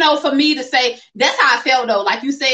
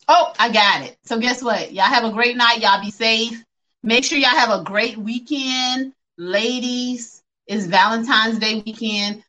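normal talking voice

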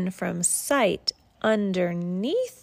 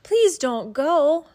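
slow, louder, high plea; pleading; 6 semitones higher, 7 dB louder, slower than usual. Acted. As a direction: beg the moon to stay. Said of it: "they both cried".

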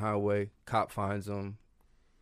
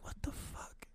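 whispered disbelief; 14 dB quieter than usual. Retold or imitated. Granddad bewildered.

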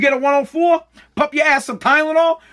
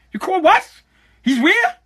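higher, incredulous questions; baffled; 8 semitones higher than usual. Retold or imitated. Dad on the phone with the school, incredulous. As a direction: sound baffled and put out.

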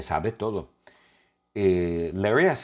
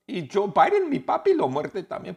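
higher hamster voice, easing toward his own; lightly squeaky hamster; 13 semitones higher, 3 dB louder than usual. Acted. Zombie hamster telling of his hungry dad, lightly squeaky. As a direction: keep a hint of the little creature.

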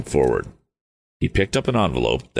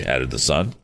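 plain reading voice

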